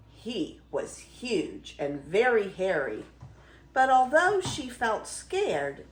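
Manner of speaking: ordinary delivery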